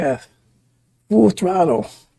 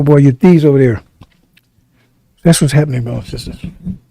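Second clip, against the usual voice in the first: ordinary talk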